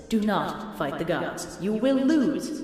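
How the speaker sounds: slow, deliberate warning; slow, stern mock warning. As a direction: spell it out word by word, slowly.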